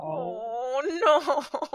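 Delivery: long, high drawn-out "oh no"; stretched-out heartbroken aww; sympathetic dismay. Pretend. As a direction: wail it in pity, rising high. Player reacts to hearing he sobs.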